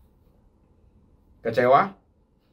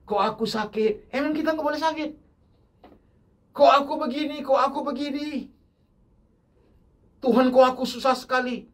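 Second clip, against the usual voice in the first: higher whiny complaining voice; grumbling believer; 12 semitones higher than usual. Retold or imitated. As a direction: mimic a congregant moaning to God. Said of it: "yang menggerutu dengan Tuhan". (meniru jemaat yang menggerutu, suara lebih tinggi dan merengek)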